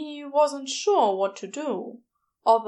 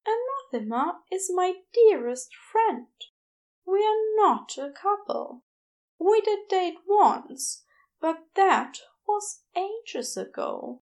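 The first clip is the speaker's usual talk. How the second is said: higher, gentle fussy Aziraphale voice, embarrassed; flustered angel; 8 semitones higher than usual. A narrator's reading. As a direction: play a flustered, apologetic angel.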